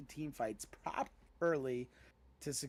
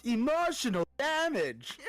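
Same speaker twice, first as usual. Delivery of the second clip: loud high yelling dad voice; emotional damage meme; 9 semitones higher, 6 dB louder than usual. Retold or imitated. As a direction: shout it like an angry meme dad. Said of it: "he's the guy with the flip-flop".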